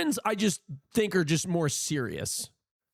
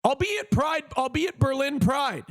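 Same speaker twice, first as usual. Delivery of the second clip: excited announcement; 7 semitones higher than usual. Real, not acted. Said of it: "repeats announcement".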